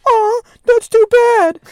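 Dorothy taunting the cowardly lion in a high voice; mock sympathy. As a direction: coo it like sarcastic pity. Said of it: "Dorothy mocking the lion".

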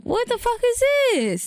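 high, slow appalled exclamation; mock disgusted outrage. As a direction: stretch it out in hammed-up disgust. Acts disgusted tampon discovery.

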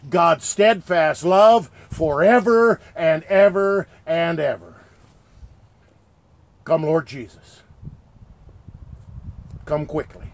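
ordinary talk

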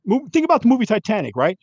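really going off, voice pushed up. Real, not acted. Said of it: "I had to go off".

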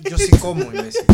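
high, loud jokey protest; playful mock outrage; indignant yelp. Real, not acted. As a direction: shoot back offended, half laughing. Mock-offended comeback between friends.

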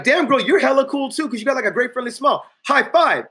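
lifted, excited compliments; high hyped flattery. Retold or imitated. Instructor flatters dancing girl into high five, excited. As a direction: pump her up with high energy.

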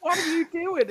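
high, loud mock girl's shriek; girl shocked at the hillbilly, high and loud. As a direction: squeal it like a startled girl. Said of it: "she's like".

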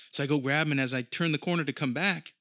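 usual speaking voice

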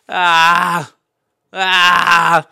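high loud ah ah; random noise. Retold or imitated. In the dry room, yelping random noises. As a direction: let out a couple of odd yelps. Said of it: "random noise".

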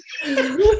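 high burst of laughter. Real, not acted. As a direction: let a big laugh burst out. Laughs at own feat.

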